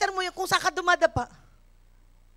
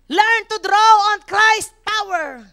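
slow, loud preaching declaration; slow emphatic sermon point; preached proclamation; 3 semitones higher, 6 dB louder, slower than usual. Real, not acted. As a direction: proclaim it slowly to the church.

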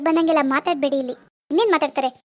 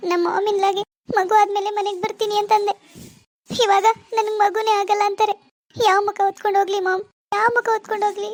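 put-on higher, tearful despairing young wife; tearful daughter; 5 semitones higher than usual. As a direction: complain to mom through tears. Daughter ashamed, weeping. (ಅಳುವ ಮಗಳು)

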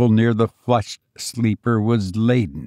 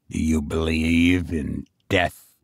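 low-pitched, grave question; solemn low voice of a sick man; grave sick master; 7 semitones lower, 4 dB quieter than usual. A narrator's reading. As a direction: ask low, as the ailing master. Sick master questions his servant.

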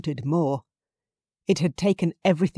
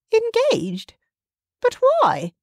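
very high, stunned disbelief; shocked mother; 6 dB louder, slower than usual. A narrator's reading. Justine shocked by the engagement.

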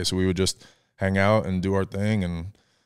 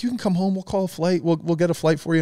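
high, eager mom voice; mom on the phone; 9 semitones higher than usual. Retold or imitated. Mom calling her homesick son, high and eager. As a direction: pitch it way up like a caring mom. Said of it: "she was like".

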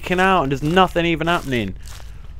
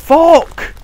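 startled yell; 9 semitones higher, 6 dB louder than usual. Real, not acted. Sudden scare in horror game.

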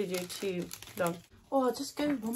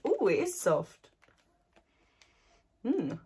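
ordinary talk

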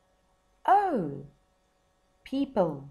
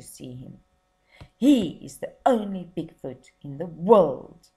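louder, greedy circus owner; a hint of a greedy ringmaster; boastful showman; 9 dB louder than usual. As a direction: brag about the money to be made.

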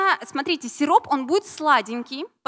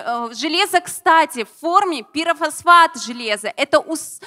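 normal talking voice

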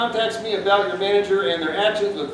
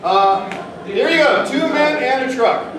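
normal talking voice

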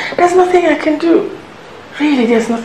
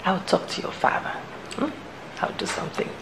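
soft, low whisper; a mother quietly reassuring her daughter; whispered promise; 6 semitones lower, 14 dB quieter, faster than usual. Acted. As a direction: murmur it as a secret.

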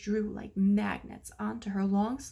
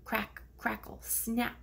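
plain reading voice

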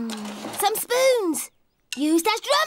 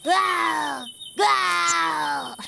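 playful growl; 4 semitones lower than usual. Pretend. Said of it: "being a little monster".

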